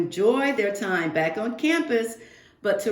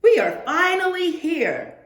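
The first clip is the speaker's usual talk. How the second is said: higher and louder, thrilled to be there; excited arrival; 8 semitones higher, 5 dB louder than usual. Pretend. Family finally reaching campus, thrilled announcement. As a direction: announce it like you can't wait another second.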